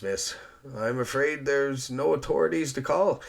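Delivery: amused, unhurried reassurance; wry island man. Acted. Rogers telling Eliza nobody will call police, wryly.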